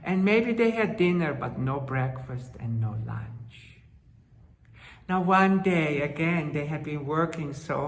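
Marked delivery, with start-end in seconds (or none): 1.3-3.8 hushed storyteller whisper, lower. A narrator's reading. Storyteller hushing the hungry children's plight in a whisper. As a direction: breathe it to the listeners like a sad secret. Storyteller stressing their hunger.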